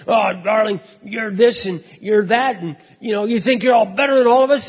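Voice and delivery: put-on high, loud, sloppy drunk voice; drunken accusation. Drunk Wally corners the speaker, loud slurred accusing whine.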